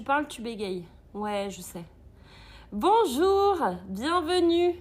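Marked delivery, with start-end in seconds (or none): 2.7-4.7 high, loud "Bonjour, bienvenue" called out; cheerful greeting. Real, not acted. Welcomes new viewers joining her live stream with a high, loud call.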